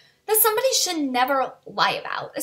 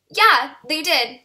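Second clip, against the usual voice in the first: slow, louder curt confirmation; retold anger; 4 semitones higher, 5 dB louder, slower than usual. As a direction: imitate a pointedly cold reply, leaning on each word. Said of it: "I was so mad".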